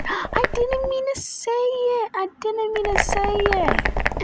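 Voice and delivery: very high-pitched mocking imitation; put-on high, pleading pick-me voice; mock pick-me girl. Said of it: "that's why we call her the pick-me girl".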